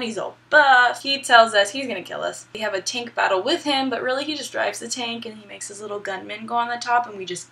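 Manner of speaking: ordinary talk, nothing marked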